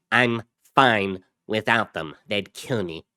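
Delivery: lightly altered walkie-talkie voice; distorted radio voice. A narrator's reading. Pico answering his brother over a walkie-talkie, distorted. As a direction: talk as if through a small handheld radio. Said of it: "Pico's distorted voice came from a small handheld radio".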